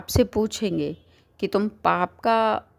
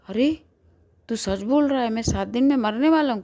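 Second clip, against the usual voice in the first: shocked disbelief; 4 semitones higher, faster than usual. Acted. Seth hears of his death.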